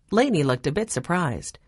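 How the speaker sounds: plain reading voice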